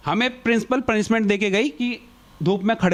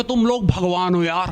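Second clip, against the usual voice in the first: overacted gratitude; 2 semitones lower than usual. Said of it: "full on over acting".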